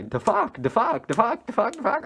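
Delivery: repeated question climbing high in pitch; looping tripping friend. Retold or imitated. Imitates friend still looping.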